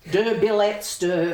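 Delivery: put-on loud, mangled French in a broad Australian accent. Guest mocking mum's French teacher, broad Australian-accented French. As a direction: exaggerate awful Australian French. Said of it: "her teacher was Australian and her French was".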